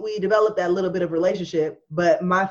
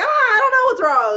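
put-on high, loud frightened child; scared child; 8 dB louder, faster than usual. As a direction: squeal like a scared little kid. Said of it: "I'd be like".

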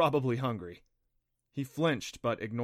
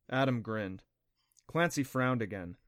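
ordinary delivery

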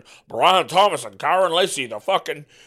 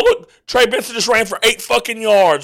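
usual speaking voice

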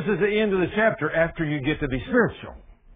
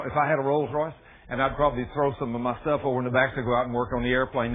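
ordinary talk, nothing marked

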